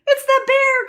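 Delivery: very high, loud terrified cry; scared child crying out; cried out. Acted. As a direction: shriek it in fright. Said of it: "cried Eddie".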